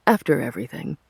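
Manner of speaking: plain reading voice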